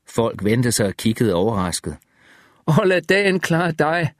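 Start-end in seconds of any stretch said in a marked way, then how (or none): 2.7-4.1 raised, higher call in reply; answering farewell call, voice lifted; called back. Acted. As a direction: shout it back across the hall. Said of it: "råbte jeg tilbage".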